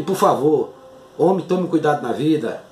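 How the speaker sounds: ordinary talk, nothing marked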